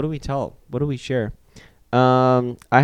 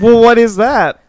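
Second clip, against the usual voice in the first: comic exasperation; 10 semitones higher, 7 dB louder, slower than usual. Real, not acted. Exasperated after being corrected by both.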